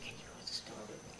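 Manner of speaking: quick hushed whisper; retold whisper. Warner asking his friend to come along, quickly whispered. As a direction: whisper it fast so nobody hears.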